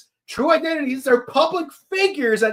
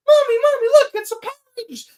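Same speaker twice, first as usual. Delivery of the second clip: put-on little girl voice; 14 semitones higher, much faster than usual. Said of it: "The little girl's like".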